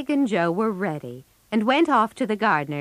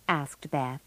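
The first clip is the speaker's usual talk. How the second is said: ordinary delivery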